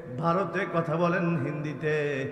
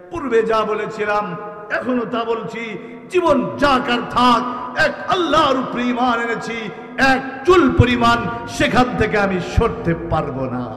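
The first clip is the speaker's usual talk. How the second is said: louder, resolute defiance; firm declaration; 6 semitones higher, 8 dB louder than usual. Acted. Companion defying the king, firm and loud. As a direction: declare it unshaken. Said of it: "দ্ব্যর্থহীন কণ্ঠে ঘোষণা দেয়".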